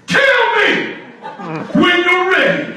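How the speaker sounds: very high, loud shout in her face; full volume yell. Retold or imitated. Hawker yelling into the old woman's face, full-volume shout. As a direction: scream it right into her face. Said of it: "at full volume".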